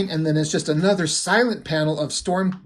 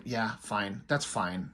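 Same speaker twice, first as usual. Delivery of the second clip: low, soft, resigned imitation of giving in; quietly conceding, voice dropped low; grudging acceptance; 7 semitones lower, 11 dB quieter, slower than usual. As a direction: grumble agreement under your breath, as in a retelling. Retelling Wolverine grudgingly giving in to Storm.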